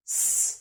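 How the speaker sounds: long voiceless "س" by itself; S sound. Shown as a demonstration. Teacher showing each letter has a sound, isolated s. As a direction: softly hiss "س" with no vowel. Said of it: "حرف ليه صوت".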